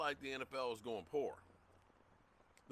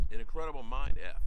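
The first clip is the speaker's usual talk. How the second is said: loud emphatic repeat; 13 dB louder than usual. Shown as a demonstration. To his chat, repeating the idea much louder. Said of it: "You know what would be an incredible mind F?".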